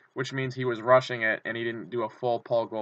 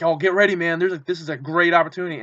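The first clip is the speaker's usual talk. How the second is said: excited friend; 5 semitones higher, 7 dB louder than usual. Retold or imitated. Friend Kyle hyped by player.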